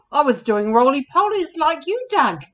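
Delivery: lightly acted wailing camel; mild wail. A narrator's reading. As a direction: wail it a little, for children. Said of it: "wailed Hannibal".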